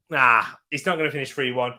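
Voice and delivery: normal talking voice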